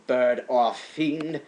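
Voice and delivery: plain reading voice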